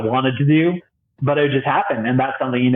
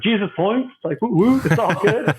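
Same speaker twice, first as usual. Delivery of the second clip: joking laugh; 8 semitones higher than usual. Retold or imitated. Joking reply about pay.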